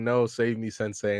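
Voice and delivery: ordinary delivery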